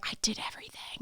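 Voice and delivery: breathy whisper, I did everything; frustrated whisper. Retold or imitated. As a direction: whisper it, exasperated. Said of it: "frustrated whisper".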